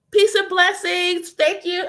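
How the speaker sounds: high, slow, reverent greeting; prayerful greeting. Shown as a demonstration. Speaking to her ancestors in prayer, reverent.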